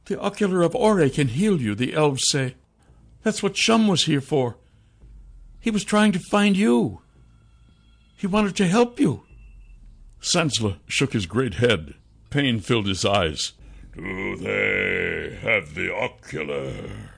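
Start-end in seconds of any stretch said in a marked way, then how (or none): 0.0-9.2 high, earnest female character voice; pleading woman. Acted. Sasha offers the king healing, high pleading voice. 14.0-17.1 soft, very slow creature voice; cursed king. Acted. Cursed king asks about the Ocular, slow soft voice.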